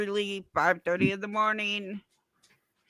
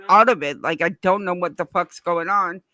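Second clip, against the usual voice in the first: ordinary talk, nothing marked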